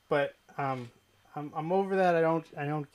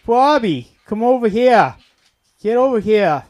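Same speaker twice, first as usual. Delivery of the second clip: loud, higher call across the house; 8 semitones higher, 15 dB louder than usual. Retold or imitated. Father calling his other son over, loud high call. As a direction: yell for the kid to come. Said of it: "And then, like".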